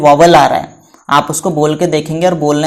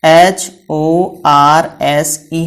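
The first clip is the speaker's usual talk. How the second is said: slow spelling; much slower than usual. Shown as a demonstration. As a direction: spell each letter slowly. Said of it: "और दूसरा लिखा मैंने".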